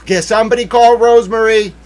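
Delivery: high, loud call for help. Pretend. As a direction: shout it out to the whole room. Urgent mock plea for help.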